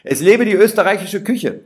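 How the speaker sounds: loud, high toast to Austria; booming cheer; rousing cheer. Acted. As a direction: call it out with full pride. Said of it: "Ich rufe".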